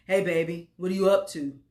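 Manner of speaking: lightly voiced man on the phone; hint of a penetrating male voice; southern baritone man. A narrator's reading. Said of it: "baritone and penetrating with a slight southern drawl".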